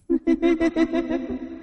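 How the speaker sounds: high eerie cackle; possessed woman laughing in the pond; shrill ghostly laugh. Acted. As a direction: let out an unearthly shriek of laughter. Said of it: "càng cười to hơn".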